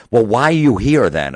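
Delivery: high, loud imitation of an indignant woman; mock indignation. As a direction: challenge him, incredulous, as her. Retold agency woman challenging him.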